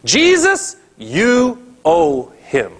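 the elders' real meaning, high and drawn out; elders demanding. Acted. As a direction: press Jesus like a debt is due.

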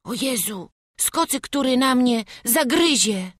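high frightened cry; scared boy. Acted. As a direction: dread the dogs. Boy fears barking dogs.

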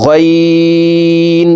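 "গইন" said full and thick, held very long on a high level tone; heavy, stretched ghain. Shown as a demonstration. Teacher demonstrating a heavy letter, long chanted hold. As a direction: draw out a heavy "গইন" as one steady note. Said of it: "গইন চার আলিফ টেনে পড়তে হবে".

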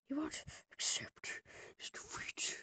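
slow, breathy whispered taunt. Real, not acted. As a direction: hiss the taunt slowly.

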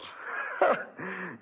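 high scornful laugh at the word prayers. Acted. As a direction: laugh him off with contempt. Villain scoffs at prayers.

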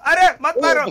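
loud frantic plea; panicking gamer. Real, not acted. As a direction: beg them not to shoot. Pleading under attack. (घबराई हुई पुकार)

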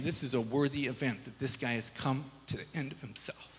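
choked up, dropping to a near whisper; real emotion taking the voice away, very soft; emotional. Real, not acted. Preacher retelling the father's welcome, overcome with emotion. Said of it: "I don't know why I'm so emotional".

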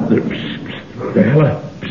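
unvoiced hiss to get attention; whispered. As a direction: pst like a secret agent. Secret agent hissing for attention.

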